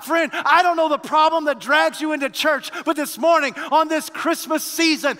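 loud, high, ringing sermon voice; preaching at full force. Acted. Preacher exhorting the Christmas congregation, loud and high. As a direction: proclaim it to the whole church.

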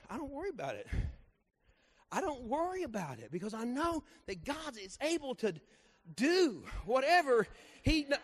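loud, high revival preaching; booming testimony preaching; preaching at full force. Acted. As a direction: lift the church with the testimony.

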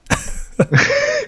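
sudden squeaky burst of laughter; high laugh. Real, not acted. Host laughing at his own robot driver joke, squeaky giggle.

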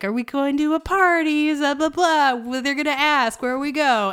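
high-pitched mock kids' chatter; kids rehearsing excuses. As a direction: imitate teens running through their cover story. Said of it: "kids are workshopping their whole story of, like".

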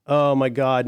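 ordinary delivery

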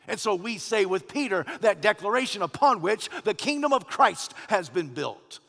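fervent pulpit climax; full-force preaching. Acted. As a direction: declare it like Easter morning. Easter declaration at full force.

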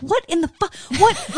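high, loud incredulous outburst; angry disbelief. Retold or imitated. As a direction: blurt it out in shocked anger. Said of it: "I'm so on a hundred angry all the time".

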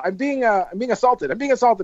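high, whiny cry of being assaulted; mock drunk nun. Drunk nun being carried out, whiny high protest. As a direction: play the drunk nun shrieking victim, lightly.

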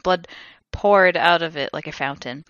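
ordinary delivery

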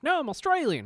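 high, loud Australian protest; mock Australian accent. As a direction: exclaim it as an exaggerated Australian. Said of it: "There's one who's an Australian".

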